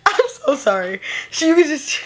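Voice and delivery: high, squeaky, helpless laughter; words strangled by real laughing fits; crying with laughter. Real, not acted. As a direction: keep breaking up mid-sentence. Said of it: "I'm so crying".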